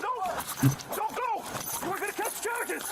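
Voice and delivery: urgent high-pitched commands; shouted police orders. Real, not acted. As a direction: yell orders at a fleeing suspect. Officer shouts orders at fleeing suspect.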